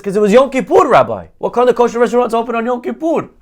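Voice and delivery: high, rushed comic protest; exasperated excuse. Acted. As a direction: throw out the punchline as if it's obvious. Man delivers exasperated punchline.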